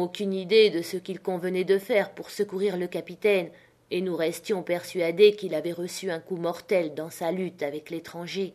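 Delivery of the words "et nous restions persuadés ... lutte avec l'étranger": ordinary delivery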